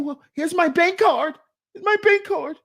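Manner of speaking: hammed-up high, frantic eagerness; desperate compliance. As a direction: plead with the officer to take it.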